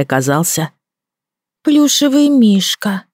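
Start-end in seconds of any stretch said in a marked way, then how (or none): 1.6-3.0 put-on high, slow, let-down child voice; disappointed drawl. A narrator's reading. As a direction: stretch it out, crestfallen. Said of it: "протянул он с явным разочарованием".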